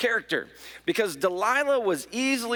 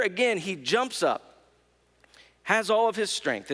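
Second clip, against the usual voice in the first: ordinary talk, nothing marked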